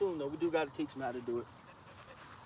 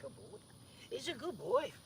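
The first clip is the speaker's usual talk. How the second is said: high praise voice; 10 semitones higher, 4 dB quieter, slower than usual. Real, not acted. Praising the dog.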